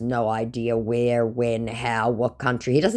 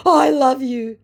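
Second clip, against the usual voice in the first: higher, louder declaration; declaring scammer; 12 semitones higher, 6 dB louder, slower than usual. Retold or imitated. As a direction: blurt out love like a besotted old man. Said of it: "he declared".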